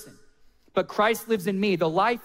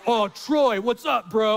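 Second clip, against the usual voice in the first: manly casual greeting; 3 semitones higher, slower than usual. Retold or imitated. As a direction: act out playing it cool with an idol. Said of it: "just stayed manly in that moment".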